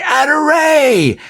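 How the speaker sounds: excited high cry of Adoray; mock bingo cry. A player calling bingo in the hall, excited cry. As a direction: shout it like you just won. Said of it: "somebody would say".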